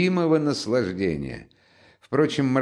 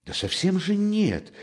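high-pitched embarrassed denial; flustered, raised-pitch objection; flustered exclamation; 11 semitones higher than usual. A narrator's reading. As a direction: protest in a much higher voice. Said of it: "восклицал Замётов, видимо, сконфуженный".

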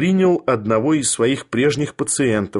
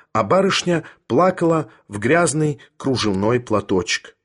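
ordinary delivery, nothing marked

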